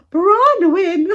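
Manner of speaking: hoog, luid, lachend gekreunde "Bronwyn"; mock moaned name. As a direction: kreun de naam spottend met een lach. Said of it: "but being like".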